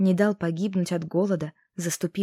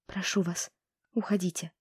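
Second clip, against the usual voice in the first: soft, quiet plea; quietly pleading; 6 dB quieter than usual. A narrator's reading. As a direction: beg him softly to leave. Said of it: "тихо ответила". (тихая, сдавленная мольба)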